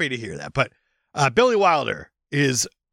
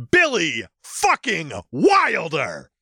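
slow, drawn-out emphasis on each word; slow emphatic; much slower than usual. Real, not acted. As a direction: savor every word of the name. Reverently naming favorite director.

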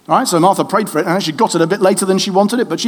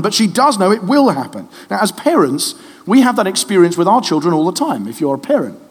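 ordinary delivery

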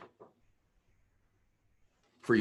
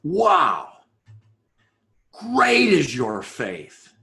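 slow, louder awed wow then declaration; 2 semitones higher, 4 dB louder, much slower than usual. Shown as a demonstration. Preacher voicing Jesus praising the woman, slow and awed. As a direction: let the wonder land slowly.

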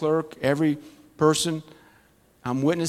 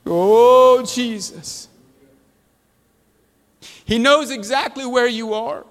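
higher, louder prayerful preaching; fervent prayer; 6 semitones higher, 4 dB louder than usual. Acted. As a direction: pray over the people with rising fervour. Prays over the congregation.

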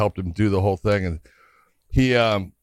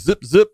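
a quick, louder, higher "zip, zip" sound effect; playful imitated slicing noise; mock razor zip; 7 semitones higher, 7 dB louder than usual. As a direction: make the razor's zip with your mouth. Scott cutting with razor.

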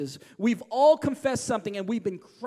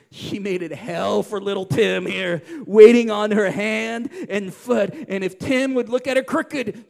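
ordinary talk

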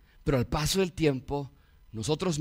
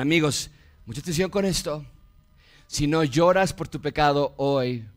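ordinary talk, nothing marked

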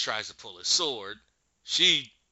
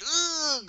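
agonized groan; 6 dB louder than usual. Retold or imitated. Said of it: "twists in agony".

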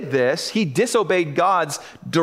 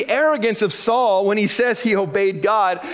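ordinary talk

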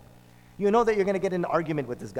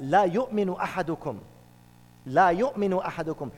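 rapid Arabic recitation of the hadith; quick hadith recitation; much faster than usual. Acted. Preacher quotes the Prophet's hadith to congregation, quick recitation. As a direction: rattle off the hadith fast.

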